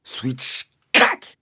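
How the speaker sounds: sharp swish then a loud crack; cane swish and crack. A narrator's reading. Narrator mimicking the cane hitting Sidney, swish then crack. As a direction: imitate the cane whipping down. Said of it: "le bruit effroyable".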